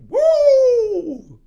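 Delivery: long, very high, loud "woo"; big whoop. Real, not acted. Reacting to the shocking tirade, loud high whoop. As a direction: let out a piercing drawn-out whoop.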